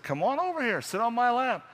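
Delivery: high grandma voice, coaxing; old grandma voice. Acted. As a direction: coax him like a grandma. Grandma voice, much higher.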